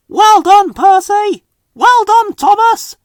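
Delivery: high loud cheering; cried out. A narrator's reading. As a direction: cheer them off with joy. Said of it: "they cried".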